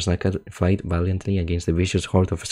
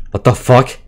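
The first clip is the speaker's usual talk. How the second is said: higher, loud, incredulous cry; stunned disbelief; 6 semitones higher, 11 dB louder than usual. Real, not acted. Streamer reacts to the creatures' reunion, loud incredulous shout. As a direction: yell it in disbelief.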